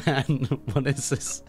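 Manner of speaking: normal talking voice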